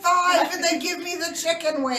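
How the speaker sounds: high, loud mock whine; aggrieved youngest child. Playing a child griping to emergency services, mock wail. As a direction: ham up a child's loud complaint.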